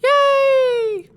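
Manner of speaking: shrill, very high and loud victory cheer. Acted. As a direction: squeal with glee after the kill. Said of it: "someone that sounds enormously like [name], goes".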